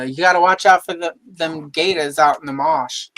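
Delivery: hammed-up Southern warning, R's dropped; Southern accent. Shown as a demonstration. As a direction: let the Southern accent kick in. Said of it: "it'll kick in".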